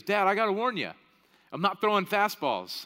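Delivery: a hint of a cocky six-year-old; six-year-old son. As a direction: warn Dad like a confident kid. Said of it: "the six-year-old gets on the mound".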